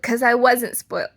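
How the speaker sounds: loud fake protest; loud mock denial. Real, not acted. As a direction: belt the denial out. Loud denial before joke.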